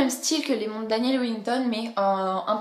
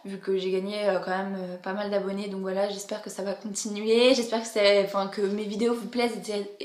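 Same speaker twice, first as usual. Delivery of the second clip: ordinary talk, nothing marked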